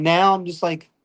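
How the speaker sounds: normal talking voice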